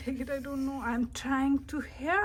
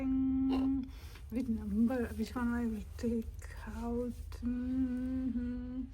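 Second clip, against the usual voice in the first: usual speaking voice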